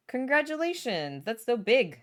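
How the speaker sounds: high, delighted congratulations after a gasp; excited congratulations. Real, not acted. Streamer congratulating viewer on internship, excited.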